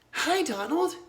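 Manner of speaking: put-on high, light girl's greeting; chirpy girl voice; young girl voice. A narrator's reading.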